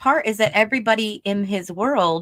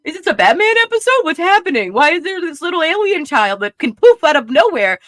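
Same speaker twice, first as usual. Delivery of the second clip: fast, high, louder disbelief; pitched-up what-is-happening rant; incredulous; 5 semitones higher, 5 dB louder than usual. Retold or imitated. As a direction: rattle off baffled questions. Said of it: "I'm like".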